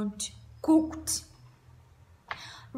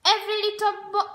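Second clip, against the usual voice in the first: ordinary delivery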